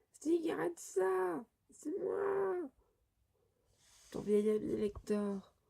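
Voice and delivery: very high, drawn-out falsetto plea; squeaky pleading. Acted. Lector pleading with hungry Sting, squeaky pleading. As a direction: plead with your friend in a tiny high voice.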